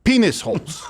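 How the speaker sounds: deadpan punchline in a mock Ukrainian accent; put-on Ukrainian accent, landing the joke; Zelensky accent. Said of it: "I'll do the accent".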